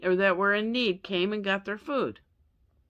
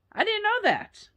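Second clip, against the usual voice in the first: surprised; 13 semitones higher than usual. Real, not acted. Surprised aside about the bathwater saying.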